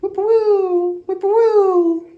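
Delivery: high, loud sung bird call; whippoorwill trill. Acted. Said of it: "his favorite trill".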